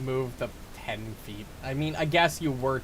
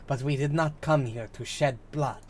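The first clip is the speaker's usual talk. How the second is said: put-on Ananta accent. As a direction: read it formally in an invented Ananta accent. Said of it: "I do the accent for the Ananta".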